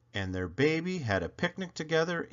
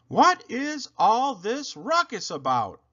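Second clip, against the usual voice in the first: policeman; 10 semitones higher, 4 dB louder than usual. A narrator's reading. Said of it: "he asked".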